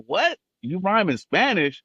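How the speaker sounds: high-pitched, incredulous question; astonished reaction. Retold or imitated. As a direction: act out their amazed disbelief. Acts label men's astonished reaction.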